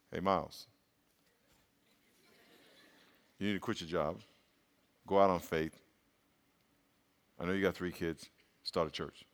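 very low, soft inner whisper; small still voice. Retold or imitated. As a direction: hush it like a conscience. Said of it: "little small still voice".

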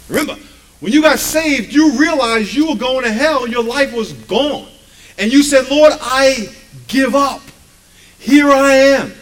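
loud, high, slow preacher's climax; preaching shout. Acted. Pastor to congregation, preaching at full force.

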